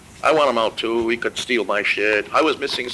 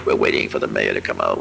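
mock news reporter, much lower voice; radio reporter. Buddy mimicking the radio reporter waiting outside, low mock anchor voice. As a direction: drop the pitch way down like a live radio anchor. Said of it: "I remember listening on the radio".

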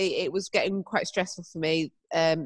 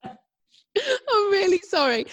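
slow, laughing through an apology; 13 semitones higher, much slower than usual. Real, not acted. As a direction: stretch it out while laughing. Laughing apology about teeth.

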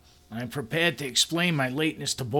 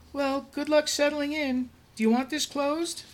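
much higher, bright cheerful coworker voice; bubbly coworker. Acted. Helga introducing herself to the new boss, much higher cheerful voice. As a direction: greet the new boss sweetly and eagerly.

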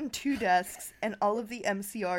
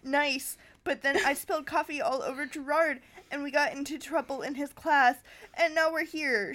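higher, fast, tearful gush; blubbering; 6 semitones higher than usual. Acted. Anna pours her troubles out to the teacher, blubbering. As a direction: blurt it out through tears. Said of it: "she blubbered".

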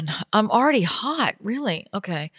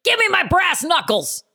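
tough housewife; 6 semitones higher than usual. Acted. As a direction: storm in demanding the zoodles.

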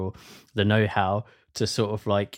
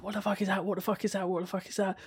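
high, frightened, repeated; panicked repeat; 11 semitones higher, 5 dB quieter, faster than usual. Retold or imitated. Mate panicking at a lamppost, high frightened repeating. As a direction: voice jumping up in fear, fading softer each time. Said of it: "And he's like".

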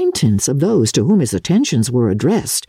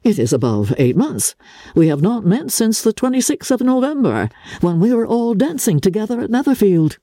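lightly raised voice for Darcy, a touch of regret; Darcy's line; 7 semitones higher than usual. A narrator's reading. To Elizabeth, recalling their last dance, mildly regretful. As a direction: read Darcy's remark with mild regret. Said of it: "he added".

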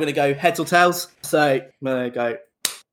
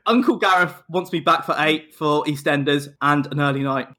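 ordinary delivery

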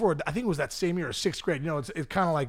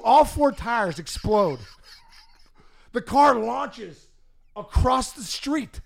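slow, higher, drawn-out words; dramatic slow telling; 6 semitones higher, much slower than usual. Retold or imitated. Comic telling the crash to a friend, dramatic slow. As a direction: stretch out the disaster.

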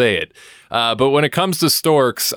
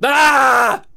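high, loud yelp of a scream; abrupt scream into the mic; sudden scream; 4 dB louder than usual. Pretend. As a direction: scream out of nowhere like a nervous tic. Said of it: "I would just scream into the microphone".

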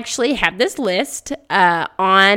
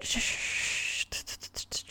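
unvoiced shushing crackle; quiet stuttered "sh" noise; imitated candle noise; 20 dB quieter than usual. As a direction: imitate a soft hissing, sputtering wick.

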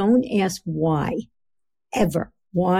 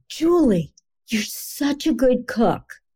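higher, slow, bright generic compliment; a sweet but empty "good cook" praise; 6 semitones higher, slower than usual. Shown as a demonstration. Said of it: "so if I say to you".

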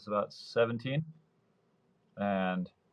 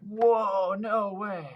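high, loud burst of amazement; a kid who can't believe his luck, up high; amazed whoa; 10 semitones higher, 4 dB louder, much slower than usual. Retold or imitated.

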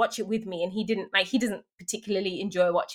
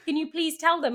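mock pleading; 6 semitones higher than usual. Begging husband to call for her.

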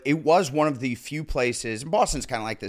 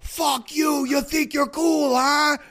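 high, loud mocking shout; sneering shout at a newly famous actor; imitated angry heckler; 9 dB louder, slower than usual. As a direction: yell it like a Philly tough picking a fight. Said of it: "some guy was like".

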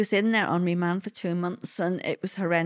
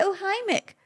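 re-enacted high-pitched hello; absent-minded greeting; 12 semitones higher than usual. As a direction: toss out the greeting high, as if by reflex. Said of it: "I just go".

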